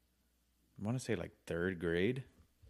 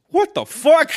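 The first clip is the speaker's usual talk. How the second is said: shocked sister; 12 dB louder, slower than usual. Retold or imitated. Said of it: "and she goes".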